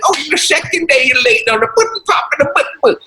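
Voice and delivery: high, put-on scolding old man voice; mock Cosby yell. Cosby scolding the late actor, in a high mock voice. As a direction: squeal the scolding like an exasperated boss. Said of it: "He yells at me again".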